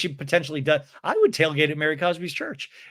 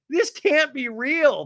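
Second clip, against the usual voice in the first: amused disbelief; 10 semitones higher, slower than usual. Real, not acted. Host cracking up at the story.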